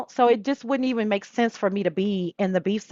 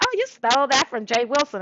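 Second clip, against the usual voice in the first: a higher put-on accusing voice; mock accuser; 4 semitones higher than usual. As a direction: tease like a know-it-all. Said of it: "somebody said".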